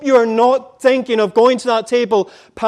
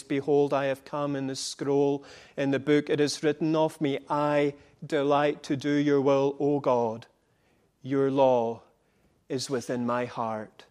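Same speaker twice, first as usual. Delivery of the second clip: quiet recitation; 6 semitones lower, 8 dB quieter than usual. Acted. Son quoting the psalm softly.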